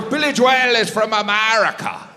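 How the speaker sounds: patient Irish correction; Irish insistence. Retold or imitated. Irish sound guy explaining to comic, mild Irish insistence. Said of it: "He goes".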